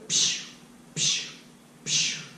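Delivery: breathy psh of water splashing; quiet imitated splashes; soft splash noise. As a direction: hiss out a splash for each dunk. Dunking laundry in river.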